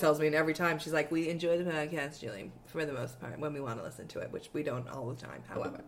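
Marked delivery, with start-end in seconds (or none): 1.1-5.8 soft, low, measured criticism; hushed lukewarm praise; imitated tactful mother. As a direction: mimic mom letting you down gently, voice dropping away. Imitating her mother's lukewarm verdict.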